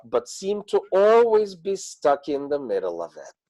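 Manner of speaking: normal talking voice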